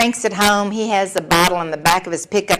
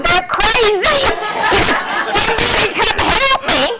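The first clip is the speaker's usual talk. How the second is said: daughter yelling from the roof; 13 semitones higher than usual. Retold or imitated. Mother voicing daughter calling for help, high pitched. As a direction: squeal it like a kid stranded on a roof. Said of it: "Laura's standing on the roof going".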